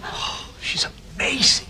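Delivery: breathless hushed awe; awed whisper. Acted. Whispering to friend about the woman. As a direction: whisper it, stunned by her beauty.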